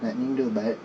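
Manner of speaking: ordinary talk, nothing marked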